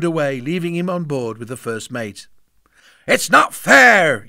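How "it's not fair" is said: put-on slow, loud childish yell. A narrator's reading. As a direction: stamp it out like a furious little boy. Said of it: "yelled Rotten Robin".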